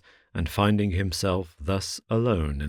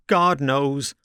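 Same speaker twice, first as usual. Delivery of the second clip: loud, higher cry at wits' end; exasperated exclamation; 8 semitones higher, 5 dB louder than usual. A narrator's reading. Bewildered Rip answering the man in the cocked hat, loud exasperation. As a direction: throw up your hands and cry it out. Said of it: "exclaimed he at his wits' end".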